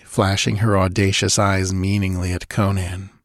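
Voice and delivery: usual speaking voice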